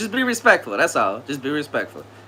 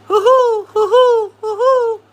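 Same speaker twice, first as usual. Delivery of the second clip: falsetto hooting; 5 dB louder, slower than usual. Acted. Playful hooting at the chat.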